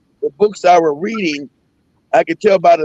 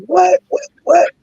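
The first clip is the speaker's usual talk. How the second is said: mock baffled repeated what, first one high; 10 semitones higher, slower than usual. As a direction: act stunned, can't follow a word. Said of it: "I'm like".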